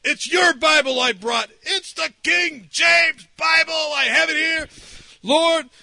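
high, emphatic plea, slowing on key words; retold fervent prayer. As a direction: plead your case urgently to the Lord. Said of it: "I was praying that".

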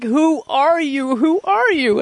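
high, slow, weeping question; tearful wonder. Retold or imitated. Weeping girlfriend asks who he has become, high and slow. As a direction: plead through tears, baffled. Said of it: "She's like".

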